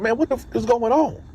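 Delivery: retold protest in a high-pitched voice; baffled protest. Inmate told to put hands behind back, baffled protest. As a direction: jump up high in disbelief.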